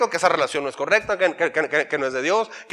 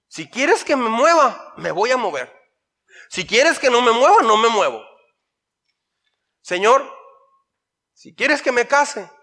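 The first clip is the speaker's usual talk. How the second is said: slow, higher, mock-devout pleading; pleading prayer; 5 semitones higher, 4 dB louder than usual. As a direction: imitate a pious yes-man praying to God. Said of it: "dice cosas así como las siguientes".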